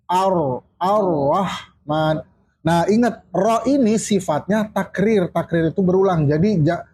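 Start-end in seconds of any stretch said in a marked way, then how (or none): 0.1-2.1 AR-RAHMAN slow, broken as ar-rah-man; clear doubled R. Shown as a demonstration. Teacher showing students the right way, slow doubled R. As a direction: hold the R briefly in "Ar-Rahman", syllable by syllable. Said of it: "Jadi harus bilang gini".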